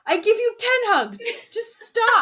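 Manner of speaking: high, loud mock exasperation; exasperated. Host imitates partner fed up with the crying, loud exasperated plea. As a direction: cut in loudly, at the end of patience.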